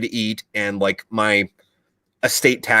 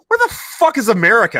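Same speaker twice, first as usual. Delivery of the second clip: much higher, louder bewildered question; mock confusion; 13 semitones higher, 5 dB louder than usual. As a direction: play the villager who never heard of America. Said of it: "he's like".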